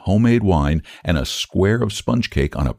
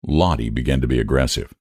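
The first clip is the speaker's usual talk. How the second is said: usual speaking voice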